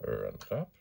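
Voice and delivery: ordinary talk, nothing marked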